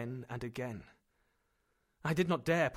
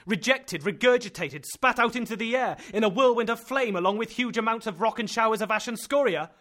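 loud and high, rapid at first; horrified, mocking the uncle's hope; frantic outburst; 8 semitones higher, 10 dB louder than usual. Axel in horror at eruption.

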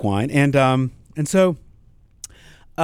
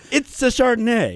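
mock grand; 10 semitones higher than usual. Mock grand pronunciation joke.

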